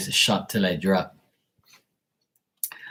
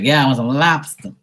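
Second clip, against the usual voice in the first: playful put-on Boston accent, pitched up; higher, mock Boston accent; 4 semitones higher, 4 dB louder than usual. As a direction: mimic Bostonians saying lobster in their accent. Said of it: "That's that, that Boston, uh, accent".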